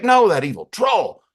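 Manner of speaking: loud, high mock outrage; outraged. [name] turns on the receptionist, outraged. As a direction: cry out as if betrayed, hammed up.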